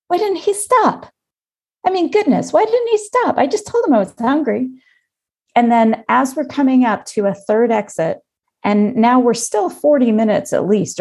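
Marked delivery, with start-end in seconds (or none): 0.1-4.7 much higher, exasperated inner rant; fuming in her head at him, pitched way up; angry inner voice. Retold or imitated. Wife seething in her head at boyfriend, high exasperated rant. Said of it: "And now I'm angry".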